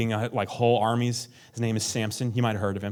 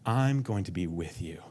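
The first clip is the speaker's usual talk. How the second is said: gentle promise; 4 semitones lower, 5 dB quieter than usual. Acted. God reassuring the overwhelmed believer, soft and tender. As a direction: lay it down tenderly. God's promise, repeated, fading.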